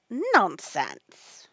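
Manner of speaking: firm, loud dismissal; loud and decided. A narrator's reading. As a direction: cut in loudly and decisively. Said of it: "very loudly and decidedly".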